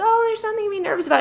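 quick, high, breezy brush-off; a high, quick retold reassurance to a nervous friend. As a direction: wave the worry away lightly and fast.

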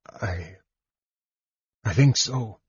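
halting, with a faint false start; unsure, faltering answer; hesitant. A narrator's reading. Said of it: "Caramon said hesitantly".

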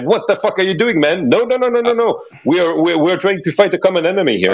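high, pleading panic, rushing at the end; frantic pigeon man begging him to stop; alarmed pigeon head. Acted. Pigeon head begging the viper to stop fighting, high panicked pleading. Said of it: "the pigeon head is like".

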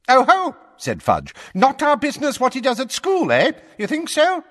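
very high, loud crowing jeer; gloating. A narrator's reading. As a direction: pounce on the point. Fudge gloating.

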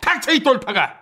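high-pitched, indignant comeback; angry retort. Acted. Insulted candidate telling his critic to shut up, angry retort. Acted quarrel, snapping back.

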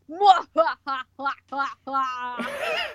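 drawn-out evil cackle; villain laugh. Pretend. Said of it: "villain laugh".

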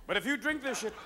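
ordinary delivery

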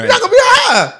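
high, very loud outburst; emphatic shout. Real, not acted. Arguing against smoking in jail, emphatic shout. Mocking the smoker's pointless choice.

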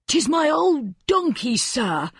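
put-on a poor old man begging for help; old peasant man. A narrator's reading. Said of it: "An old man stood".

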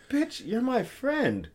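high, drawn-out playful protest; mock protest. Man to offended female friend, mock protest. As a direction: stretch it out high, playfully indignant.

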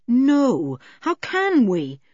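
mild worry, slowing on the question; troubled. A narrator's reading. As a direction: voice concern, drawing out the words.

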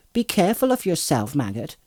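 high-pitched call from a worried wife. A narrator's reading. Farmer's wife to departing husband, calling out. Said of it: "she called".

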